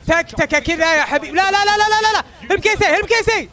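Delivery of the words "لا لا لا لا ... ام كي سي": high, loud panicked protest; frantic no no. Real, not acted. Streamer panicking as his team loses the fight, high frantic cries. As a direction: beg the play not to go wrong.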